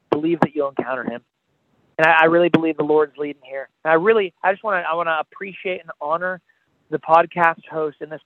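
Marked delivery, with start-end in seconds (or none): none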